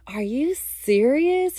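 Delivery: high, drawn-out incredulous question; amused disbelief. Retold or imitated. Boyfriend busts out laughing at the trip reveal, amused and incredulous. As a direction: imitate his delighted disbelief, stretching it out. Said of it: "he busts out laughing".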